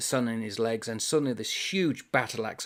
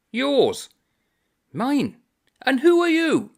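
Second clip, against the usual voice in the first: much higher, louder indignant questions; high loud exchange; 9 dB louder, slower than usual. Acted. The Irishman questioning the unseen author, high and indignant. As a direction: squeal it out in outrage.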